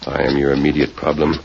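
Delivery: low, controlled threat; quiet menace. Acted. Man warns his captive not to raise his voice, quiet menace.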